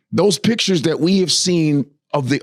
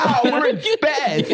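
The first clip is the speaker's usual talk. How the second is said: higher, slow, hammed-up wonder; mock awe; 6 semitones higher, slower than usual. Comic mocking astronauts' wide-eyed awe. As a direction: gawp at space like a tourist.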